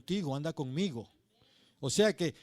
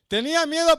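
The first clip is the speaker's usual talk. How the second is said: higher and louder, preaching force; emphatic cry; 10 semitones higher, 10 dB louder than usual. Real, not acted. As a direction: cry out the fear. Repeated with force.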